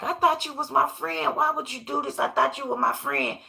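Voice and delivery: put-on a touch of betrayed little girl; hurt Kizzy. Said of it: "and Kizzy like".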